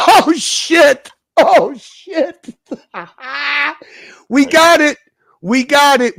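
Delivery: thrilled at finding the clip; excited laughing. Real, not acted. Finds the old clip, laughing.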